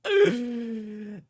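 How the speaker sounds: sudden high crying laugh; high burst of laughter. Real, not acted. Laughing at the comment.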